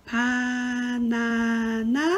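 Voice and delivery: banana drawn out very slowly; slow model word. Shown as a demonstration. Teacher modelling the loanword for students, very slow. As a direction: stretch out banana syllable by syllable. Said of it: "네, 그건".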